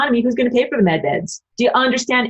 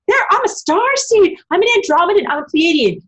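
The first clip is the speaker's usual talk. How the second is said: much higher, mocking sing-song voice; mocking starseed; 10 semitones higher than usual. Mocking starseed believers, in a high parody voice. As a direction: parody a smug starseed bragging. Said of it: "if anybody on Earth who says".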